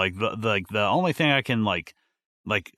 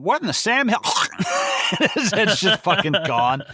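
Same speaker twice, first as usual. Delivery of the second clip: high, loud, incredulous, breaking into a laugh; shocked outcry that cracks up into laughter; stunned disbelief; 10 semitones higher, 4 dB louder than usual. Real, not acted. Reacting to a brutal scene.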